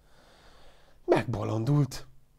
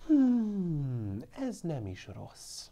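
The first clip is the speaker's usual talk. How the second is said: goat voice; 6 semitones higher, 3 dB quieter, much slower than usual. A narrator's reading. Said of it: "mondta volna".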